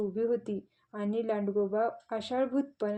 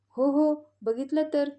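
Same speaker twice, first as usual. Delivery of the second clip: eager, louder and higher yes-yes; eager reply; 5 semitones higher, 7 dB louder than usual. Acted. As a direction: jump in keenly to answer.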